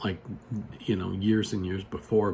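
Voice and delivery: ordinary talk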